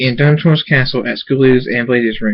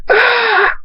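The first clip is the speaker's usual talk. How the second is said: very high piercing dying scream; stabbed thug's scream; 3 dB louder than usual. Acted. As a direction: shriek as the blade goes in. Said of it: "Skull screams".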